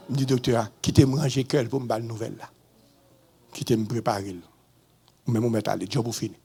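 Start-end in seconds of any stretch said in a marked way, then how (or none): none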